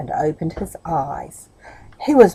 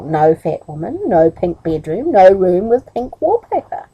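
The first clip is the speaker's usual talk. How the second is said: usual speaking voice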